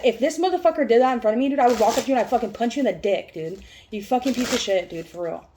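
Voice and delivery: loud, fast furious threat; angry threat. Retold or imitated. Retelling her threat to the bottle-thrower, loud and furious. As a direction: spit it out like you'd really throw the punch. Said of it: "And I said".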